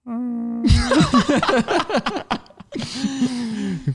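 high burst of real laughter; big laugh. Real, not acted. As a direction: laugh out loud, delighted. S1 laughs at the sloth voice.

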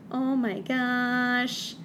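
slumped groan. A narrator's reading. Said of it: "Savannah slumped".

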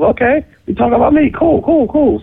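high-pitched, retold "cool, cool"; stung acceptance. Rapper concluding the lyric targets him, stung acceptance. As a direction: accept it as a declaration of war.

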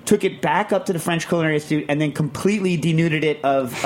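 usual speaking voice

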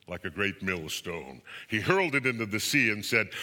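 plain reading voice

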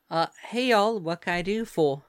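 lilting Southern US greeting; friendly Southern accent; Southern belle. Acted. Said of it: "Come on, Southern Belle, y'all".

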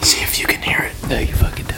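unvoiced ASMR whisper; breathy hush into the mic. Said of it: "A S M R".

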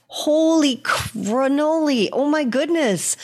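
high, drawn-out exclamation of wonder; amazed delight. Real, not acted. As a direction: blown away, pitch lifted. Amazed reaction to trucking metaphor.

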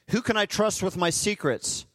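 ordinary talk, nothing marked

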